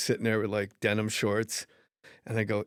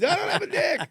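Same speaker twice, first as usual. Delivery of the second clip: much higher, shrill mock panic; panicked cry; 5 dB louder than usual. Hypnotized guy running on stage, mock panic. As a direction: wail it out in hammed-up terror. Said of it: "runs back out and goes on stage".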